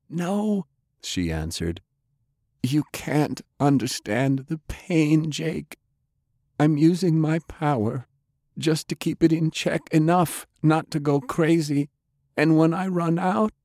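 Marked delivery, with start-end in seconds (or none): 0.1-0.7 high-pitched woman's no; refusing woman. A narrator's reading. Undead Delilah turning down Jake's hope, high-voiced refusal. 2.6-13.5 high-pitched woman's voice, sorrowful; dying woman, pleading. A narrator's reading. Undead Delilah asking Jake to let go, pleading.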